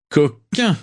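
«Coquin» lancé plus aigu, amusé; taquin. Acted. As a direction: une pique complice. Rosier taquine Nott en riant. (Rosier taquine Nott, aigu et amusé)

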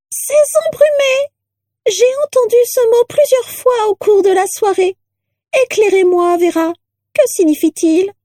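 very high, shrill curiosity; rattle-voiced portrait. A narrator's reading. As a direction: ask in a piercing, reedy portrait voice. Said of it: "une voix de crécelle".

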